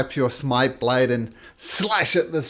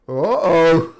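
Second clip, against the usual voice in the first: loud, higher mock dismay; alarmed monster's uh-oh; 4 semitones higher, 11 dB louder than usual. Game master as a monster seeing its buddy die, loud alarmed uh-oh. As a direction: blurt it as a worried monster.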